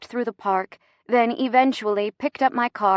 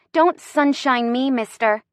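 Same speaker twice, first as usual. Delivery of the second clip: high, slow-paced telling-off; exasperated rebuff; indignant scolding; 5 semitones higher than usual. Acted. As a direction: scold with mock formality. Rebuffs his endearment.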